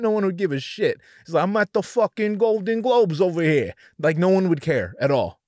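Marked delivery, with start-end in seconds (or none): 1.4-3.7 higher, put-on New York accent. Imagined Chet at the Golden Globes, New York accent. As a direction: do a comic New Yorker, pitch lifted. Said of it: "if he did a New York accent".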